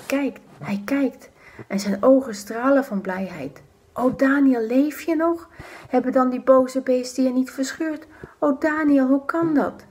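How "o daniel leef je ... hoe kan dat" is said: amazed joyful king. Acted. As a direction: call down in disbelieving joy. King's amazed joy. (verbaasde, blije koning)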